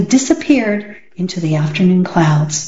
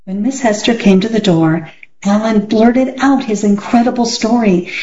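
ordinary delivery, nothing marked

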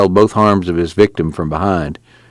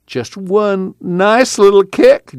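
high, slow wheedling plea; mock-sweet begging voice; pleading; 12 semitones higher, much slower than usual. A narrator's reading. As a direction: coax him like begging for a treat.

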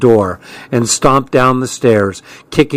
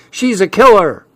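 playful holler; 7 semitones higher than usual. A narrator's reading. Boy teasing his sister as a killer, hollering. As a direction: holler it out like a warning. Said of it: "he hollered".

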